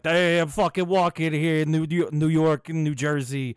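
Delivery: put-on loud, high New York accent; brash New York street accent. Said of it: "you got all your generic American accents".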